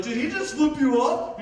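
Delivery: put-on drunk's friends shouting in outrage; angry yell. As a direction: bristle for a fight. Friends angrily check.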